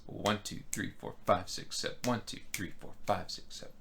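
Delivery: "One" pushed, later numbers fading soft; counting to seven with accents. Shown as a demonstration. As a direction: count it out, hitting the strong beats. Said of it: "emphasizing the right notes".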